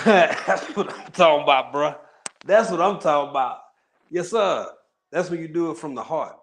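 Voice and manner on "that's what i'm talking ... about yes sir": hyped approval. Real, not acted. Hyped reaction to comment.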